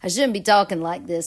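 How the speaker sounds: usual speaking voice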